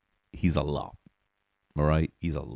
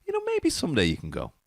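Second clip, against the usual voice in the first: slightly higher, placating mom impression; lightly voiced mom; 6 semitones higher than usual. Mom putting off a pleading child, placating. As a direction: imitate mom gently fobbing off a child. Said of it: "My mom would be like".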